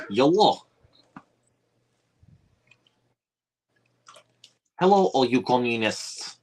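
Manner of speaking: high, put-on Russian hello; hammy Russian comrade greeting; Russian accent. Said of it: "I can speak in a Russian accent".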